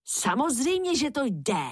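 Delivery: understated narrated call. A narrator's reading. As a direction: call it out lightly, starting high. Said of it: "zavolal jeho bratr".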